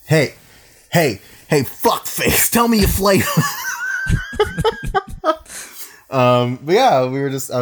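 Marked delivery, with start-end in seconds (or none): 0.0-3.2 mock rowdy greeting. Friend playfully calls out greeting. 3.3-5.8 real laughter after a joke; high shrieking laugh. Real, not acted.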